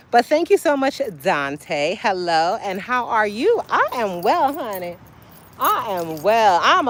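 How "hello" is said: usual speaking voice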